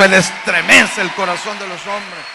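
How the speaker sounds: strong declaration with the weight on Él; proclaiming. Real, not acted. Preacher declares it before asking the crowd to repeat, loud. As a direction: proclaim it firmly so they echo it. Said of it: "Dígalo".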